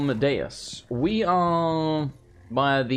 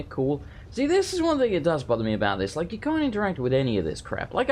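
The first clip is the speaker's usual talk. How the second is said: normal talking voice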